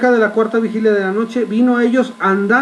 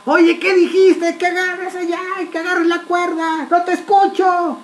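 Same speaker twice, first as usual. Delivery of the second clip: high, strained call across howling wind; shouting over the storm; 9 semitones higher than usual. Acted. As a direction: yell to a shipmate who can't hear you.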